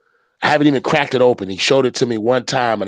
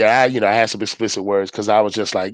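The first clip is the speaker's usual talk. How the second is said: usual speaking voice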